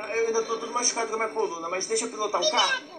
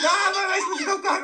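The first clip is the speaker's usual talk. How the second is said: high, raised-voice objection; urgent protest; 7 semitones higher, 4 dB louder than usual. Pretend. Telling Arlequina to blow up her car instead, urgent protest. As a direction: protest quickly to save your car.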